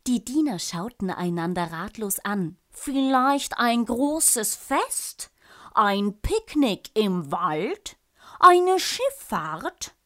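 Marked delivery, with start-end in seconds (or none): 2.7-10.0 slow, louder, rising questions; eager servants suggesting. A narrator's reading. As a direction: offer each idea higher and louder. Servants suggesting ideas to bored king.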